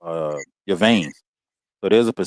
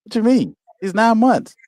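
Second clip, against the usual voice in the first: reliving upset disbelief, pitch leaping high at the end; distraught disbelief; 7 semitones higher than usual. Retold or imitated. Father reacting to his baby's cancer news, distraught disbelief. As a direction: retell a father protesting the news, voice climbing. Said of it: "I can't hold it together".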